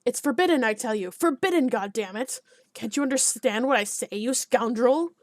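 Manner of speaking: angry shout, slowing on scoundrel; alderman shouting. Acted. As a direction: bellow at the peasant, red with rage. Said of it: "the alderman's shouts were audible".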